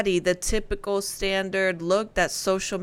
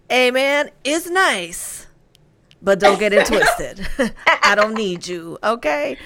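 sassy imitation; 4 dB louder than usual. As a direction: tease her line back with attitude. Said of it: "She goes".

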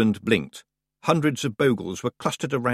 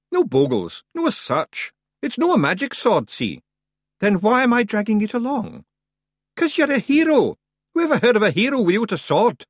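high, loud broad Scottish pixie voice; a cocky wee Scots fighter; tiny Scots warrior; 11 semitones higher, 5 dB louder than usual. Acted. Rob Anybody answering the boy, high broad Scots.

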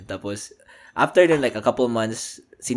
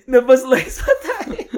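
laughing; 14 semitones higher, 6 dB louder, much slower than usual. Real, not acted. Laughing joke about sliced potatoes.

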